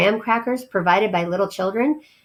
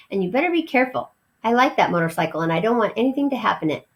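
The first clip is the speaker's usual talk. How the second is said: fast, hurried caution; quick warning; faster than usual. Acted. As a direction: rattle off the warning. Keith warns Ralph.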